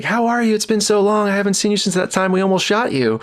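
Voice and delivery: high, bubbly mock greeting; excited greeting. Joe hugging the sound guy in front of the cast, high excited greeting. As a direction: gush it out like an old friend.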